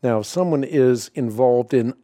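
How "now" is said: ordinary delivery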